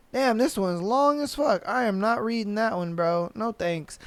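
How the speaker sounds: muttered aside. Real, not acted. Muttered aside while skipping.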